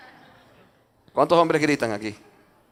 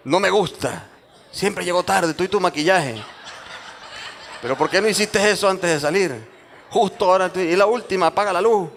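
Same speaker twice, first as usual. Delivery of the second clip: higher, scolding complaint; acted nagging; 5 semitones higher than usual. Spouse scolding a late partner before church, comic nagging. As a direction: nag with pitch raised, playfully exasperated.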